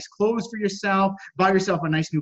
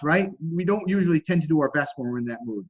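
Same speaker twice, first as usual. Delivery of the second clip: ordinary talk, nothing marked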